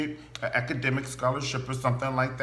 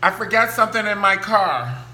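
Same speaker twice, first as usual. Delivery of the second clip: loud excuse; 8 semitones higher, 9 dB louder than usual. Retold or imitated. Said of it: "you be like".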